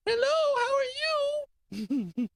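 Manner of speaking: put-on very high, slow baby talk; squeaky, stretched-out baby voice. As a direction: coo it like a grown man talking for his baby. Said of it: "he started going".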